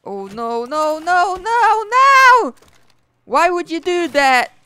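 Oh no, no, no, no!; crying with rage. Real, not acted. Game blocks setup, anguished outburst.

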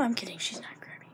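quick unvoiced whisper. Real, not acted. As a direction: take it back in a hush.

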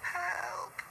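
breathless high help; weak gasp. A narrator's reading. Sixteen lying wrecked, gasping for help. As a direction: gasp it out weakly. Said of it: "he gasped weakly".

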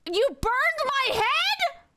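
high drawn-out disbelief; can't believe it happened; shocked. Real, not acted. Player shocked at chat burning their head, high disbelief. Streamer shocked by game event.

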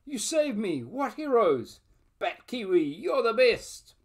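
high excited thanks; excited cry. Acted.

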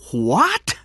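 high, loud exclamation of disbelief; astonished. Acted. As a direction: burst out in amazement, pitch leaping up. Cameron stunned by the figure.

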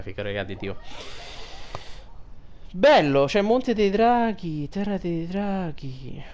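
ordinary delivery

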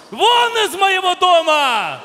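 slow, high, furious order; head of the family thunders out his verdict; banishing roar. Acted. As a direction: throw him out of the house.

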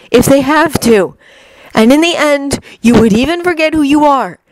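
persuasive Loki. Acted. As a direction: press the dark picture on him. Loki voiced by reader.

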